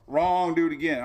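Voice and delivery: mock website rejection. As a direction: bark the rejection like a computer.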